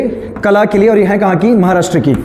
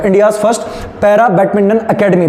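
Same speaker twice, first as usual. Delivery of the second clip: dictating; much slower than usual. Shown as a demonstration. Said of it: "Bharat की प्रथम para badminton अकादमी कहाँ बन रही".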